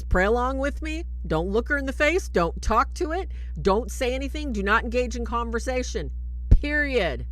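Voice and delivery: loud, high, stern priest orders; stern commands. Retold or imitated. Priest sternly orders Michael before the exorcism, loud and high. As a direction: bark the rules at the trainee.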